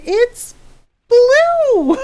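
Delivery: high, loud cry on a drawn BLUE; exasperated insistence. Retold or imitated. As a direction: pitch it way up and belt out BLUE. Retold exasperated insistence on colour.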